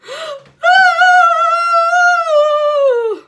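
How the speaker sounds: an even longer, loud, high sobbing wail held out. Acted. Teacher outside the door, longest loud crying wail. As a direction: wail it out as long as you can. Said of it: "an even longer one".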